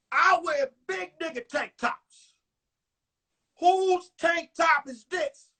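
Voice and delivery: acted boyfriend demanding answers; indignant demand. Man's demand escalates in acted story.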